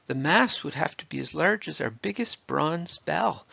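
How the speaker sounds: a touch of a cry from the crowd. A narrator's reading. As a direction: call it out over the crowd. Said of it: "Another voice cried out".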